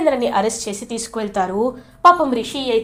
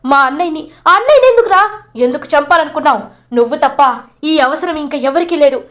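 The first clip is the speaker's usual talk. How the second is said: high, loud accusing rant; furious confrontation; 4 semitones higher, 3 dB louder than usual. Retold or imitated. Mahendra confronts Shailendra over his wife's death, furious yelling. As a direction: demand answers, voice rising to a yell. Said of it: "గట్టిగా నిలదీస్తాడు".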